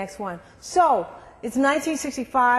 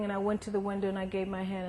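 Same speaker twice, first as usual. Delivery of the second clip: normal talking voice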